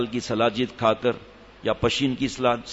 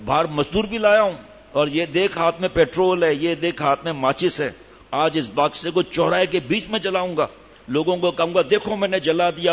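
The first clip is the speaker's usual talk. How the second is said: angry threatening husband; 2 semitones higher than usual. Acted. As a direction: rage at the box so the man inside hears. Husband threatens to burn the box.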